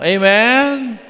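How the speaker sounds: preacher prodding the silent congregation for an amen; preacher's call. Real, not acted. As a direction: prompt the crowd. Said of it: "calling for response".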